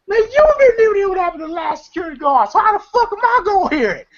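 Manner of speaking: very high, rapid indignant squawk; Day Day. Retold or imitated. Guard snapping back at Craig's warning, high mock indignation. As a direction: imitate a worked-up guard firing back. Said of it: "I like how Day Day goes".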